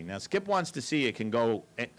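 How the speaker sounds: ordinary talk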